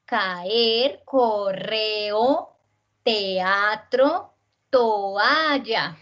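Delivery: "Caer", "correo", "teatro", "toalla" slowly, each vowel its own syllable; separated vowels. Shown as a demonstration. Teacher showing hiatus, "Caer, correo, teatro, toalla" slow with vowels separated. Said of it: "se separan".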